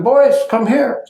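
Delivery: high, slow inviting call; gentle calling. Retold or imitated. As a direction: coax shy children over kindly. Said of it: "Baba called them".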